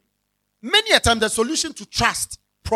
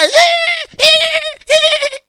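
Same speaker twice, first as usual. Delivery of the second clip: high, loud, strained ee sounds; shrill shriek; 8 dB louder, much slower than usual. Shown as a demonstration. Preacher mimics what a shouting partner sounds like. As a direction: squeal it like noise nobody can follow. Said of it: "Oh, we hear".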